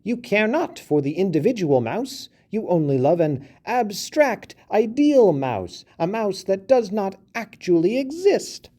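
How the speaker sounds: high, squeaky voice of a little mouse; a small mouse scolding a big elephant; indignant mouse. A narrator's reading.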